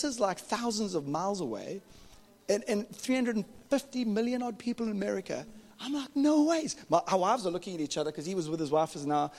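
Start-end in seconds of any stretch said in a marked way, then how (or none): 6.2-6.8 stunned disbelief. Retold or imitated. Disbelief at meeting cap guy.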